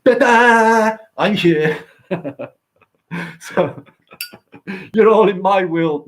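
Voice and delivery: hammed-up high, loud showman's fanfare; grand arrival. Bursting into a band to announce his own arrival, high and loud. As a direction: announce yourself like a big entrance. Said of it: "I'm like".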